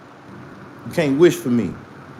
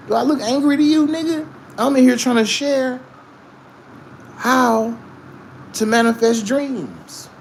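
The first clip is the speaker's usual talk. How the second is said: heated retort; 9 semitones higher than usual. Real, not acted. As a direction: snap back at the chat, offended. Streamer arguing with hater in chat.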